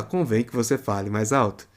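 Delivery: ordinary delivery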